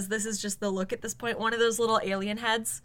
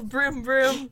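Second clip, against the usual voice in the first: louder vroom vroom engine; motorcycle revving sound; 3 semitones higher, 5 dB louder than usual. Acted. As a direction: rev the bike up. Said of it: "I'm on my mum's motorcycle".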